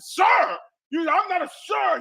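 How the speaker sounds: high, loud, hammed-up outrage; imitating an offended customer yelling; mock angry shout.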